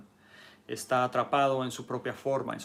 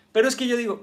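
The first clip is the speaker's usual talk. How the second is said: much higher and louder, digging in; stubborn insistence; 11 semitones higher, 10 dB louder, much faster than usual. Acted. Rigid person pushing back on the student, loud insistence. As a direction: insist like someone who won't move.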